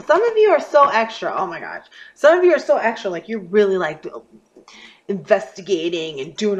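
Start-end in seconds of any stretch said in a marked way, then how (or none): 0.0-1.3 loud, high exasperated jab; loud exasperated teasing; exasperated outburst. Real, not acted. Exasperated call-out to viewers. 2.1-3.1 loud, high exasperated jab; loud exasperated teasing; exasperated outburst. Real, not acted. Repeats the exasperated call-out.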